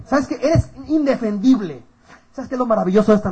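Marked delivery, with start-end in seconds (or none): 0.4-1.8 "indefendible" stretched out slowly and high; emphatic verdict. Acted. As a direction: drag out "indefendible" as an accusation. Repite la acusación con énfasis. ("indefendible" recalcado, lento y agudo)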